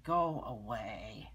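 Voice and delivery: slow, low grouchy dismissal. Acted. Storyteller voicing the grouchy ladybug, grouchy. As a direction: drag it out low and grumpy. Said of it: "the grouchy ladybug is saying".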